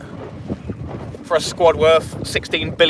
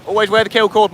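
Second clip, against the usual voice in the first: ordinary talk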